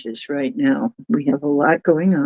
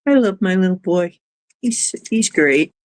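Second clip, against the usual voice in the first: ordinary talk, nothing marked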